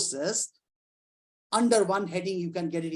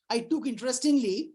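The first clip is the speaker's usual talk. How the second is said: normal talking voice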